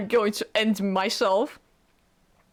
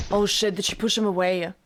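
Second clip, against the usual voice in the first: normal talking voice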